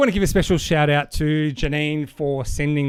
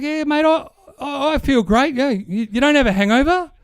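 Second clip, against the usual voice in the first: a higher, lightly voiced Aussie bloke; phone call to his drinking mate, lightly voiced and higher; 10 semitones higher than usual. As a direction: play Pete a bit higher. Pete voiced in the dad joke.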